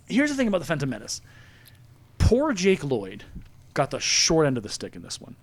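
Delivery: ordinary talk, nothing marked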